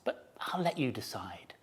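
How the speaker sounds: hushed conspiratorial whisper; father fox whispering to his cubs. A narrator's reading. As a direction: lean in and whisper it.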